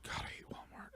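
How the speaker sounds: breathy under-breath whisper. Retold or imitated. Shopper hit by the smell, whispering. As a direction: mutter it to yourself in a whisper. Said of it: "and I'm like".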